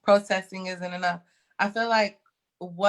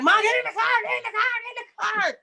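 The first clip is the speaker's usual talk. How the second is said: frantic; 12 semitones higher, faster than usual. Retold or imitated. Daughter begging her mom to get back in the car, frantic. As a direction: plead desperately, voice way up.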